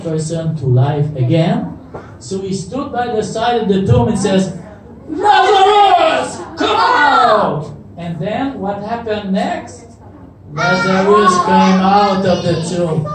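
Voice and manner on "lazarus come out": high, drawn-out call; commanding shout. Retold or imitated. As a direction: call the dead man out of the tomb. Jesus calls into the tomb.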